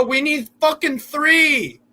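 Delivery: "three" dragged out, high and slow; "We need fucking three" with three stretched high; stress on three. Real, not acted. Demanding a win from team.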